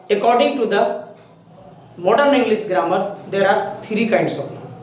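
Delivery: slow, word-by-word dictation pace. Shown as a demonstration. Teacher dictating to students, slow and deliberate. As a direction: spell it out slowly for the class to write.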